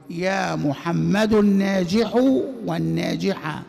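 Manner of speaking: الناجح stretched slowly; deliberate classroom recitation; slow demonstration. Shown as a demonstration. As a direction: dictate the phrase to the class. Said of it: "repeats example slowly".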